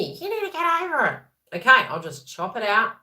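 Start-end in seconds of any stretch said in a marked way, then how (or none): 0.0-1.6 high, shrill-sounding mock nag; nagging family. Family members nagging the viewer, a mock high-pitched nag. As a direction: lecture them like a nagging relative. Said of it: "they're nagging you".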